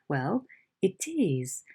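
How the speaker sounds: ordinary talk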